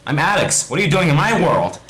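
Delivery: high, loud, quick and curt. Acted. Alex challenging Steve over the world, curt. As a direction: demand it sharply. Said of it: "he says curtly".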